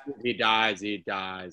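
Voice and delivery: flat, fading deadpan threat; a hint of the Rocky IV villain; Rocky villain quote. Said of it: "Rocky four moment".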